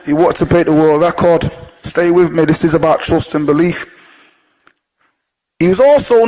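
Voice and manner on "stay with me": ordinary talk, nothing marked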